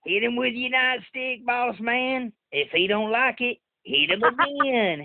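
imitating the uncle heckling the wrestler. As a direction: holler like a rowdy fan at the cage. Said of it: "He says".

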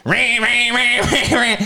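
a mild mock machine whine; lightly voiced rattling motor; imitated grinding. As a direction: mimic the jammed disposal grinding. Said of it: "the garbage disposal goes".

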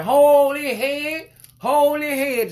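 very high, loud, slow screech; mock hollering. Mocking a lady yelling at a father in the store. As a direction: overdo her shriek while pointing. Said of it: "you hollering".

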